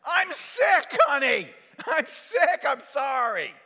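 very high, loud wail; acted pitiful outcry; desperate protest. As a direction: plead sickness at a shout.

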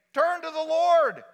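high, loud public proclamation; ringing crowd-addressing cry; preaching to a crowd. Acted. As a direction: call out over a hundred heads. Example of preaching before a crowd.

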